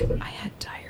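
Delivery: sheepish unvoiced whisper. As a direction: whisper it in embarrassment. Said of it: "And I was like".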